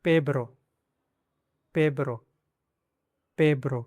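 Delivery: पेब्रो with a b before the r; practice word. Shown as a demonstration. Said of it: "आप इसको pronounce कीजिए". ("पेब्रो" अभ्यास के लिए ब जोड़कर)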